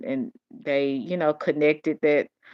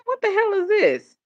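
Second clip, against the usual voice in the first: high, outraged exclamation; stunned at men in red dresses; what-on-earth squeal; shocked disbelief; 3 dB louder than usual. Retold or imitated. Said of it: "I said".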